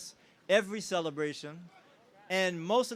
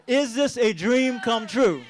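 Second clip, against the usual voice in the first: slower, louder rallying shout; louder crowd call; 4 semitones higher, 7 dB louder than usual. Real, not acted. Speaker asks the crowd again, louder and slower. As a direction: make the whole room answer. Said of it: "One more time".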